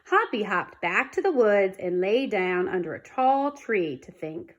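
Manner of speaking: ordinary delivery, nothing marked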